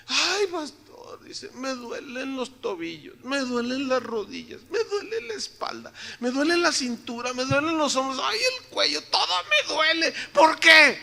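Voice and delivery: a pastor imitating an aching grandmother's whine; complaining old lady. Complaining elderly sister acted out.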